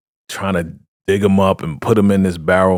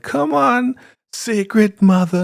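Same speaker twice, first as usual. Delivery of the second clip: much higher, slow sweet coaxing; slower than usual. Retold or imitated. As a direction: sweet-talk her slowly. Elder Mother coaxing Ruth.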